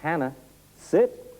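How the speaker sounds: sharp rising command sit; command to the dog. Shown as a demonstration. Trainer commanding the dog to sit. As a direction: call her name then snap sit. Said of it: "So I can say".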